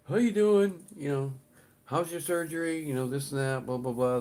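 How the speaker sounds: high, loud greeting easing down into offhand blah-blah; friendly greeting. Retold or imitated. Deli worker chatting with regular customers, imitated friendly greeting.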